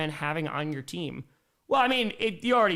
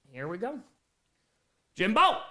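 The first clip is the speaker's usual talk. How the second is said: usual speaking voice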